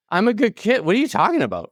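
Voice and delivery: put-on baffled kid pleading he did nothing wrong; indignant disbelief. Recalling the principal's warning, high indignant protest.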